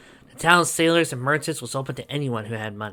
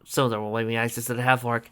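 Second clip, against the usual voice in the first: ordinary delivery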